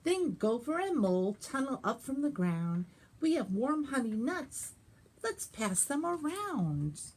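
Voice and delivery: put-on lively read-aloud for children; animated picture-book reading; story narration. A narrator's reading. Librarian reads picture book.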